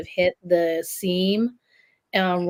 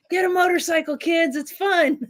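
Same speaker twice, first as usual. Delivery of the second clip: imitated holler; 9 semitones higher than usual. Friend heckling kids pedaling by on bikes, imitated holler. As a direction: call out high, imitating a heckler. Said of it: "he hollers out".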